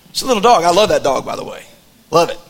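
usual speaking voice

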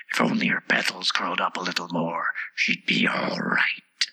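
low, whispery flower voice; dismissive Tiger Lily. A narrator's reading. The Tiger Lily remarks on Alice, low and dismissive.

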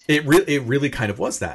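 ordinary talk

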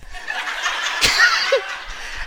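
big laugh. Real, not acted. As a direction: crack up at the punchline. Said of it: "laughs at own story".

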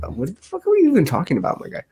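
baffled journalist. Retold or imitated. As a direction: toss it back confused. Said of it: "the journalist was like".

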